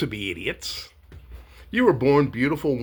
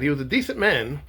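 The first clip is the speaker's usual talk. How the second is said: ordinary talk, nothing marked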